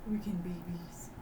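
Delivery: hushed aside to the table; whispered player aside during a tense scene. Real, not acted. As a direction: lean in and whisper the theory.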